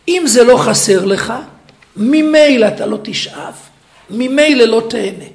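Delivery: loud, slow, hammering each phrase; full-force preaching. Acted. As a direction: preach it out to the hall.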